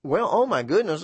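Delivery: ordinary talk, nothing marked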